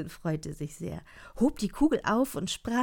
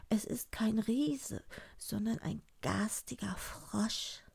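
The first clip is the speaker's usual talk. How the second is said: slow, high, whispery princess; hushed high princess voice; frightened princess; 3 semitones higher, 6 dB quieter, slower than usual. Acted. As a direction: confess it softly in a high voice. Princess answers her father.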